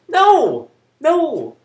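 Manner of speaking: sharp shouted refusal; furious yelled denial; loud no. Real, not acted. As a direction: bark it out, high and loud. Angrily rejecting gang logic.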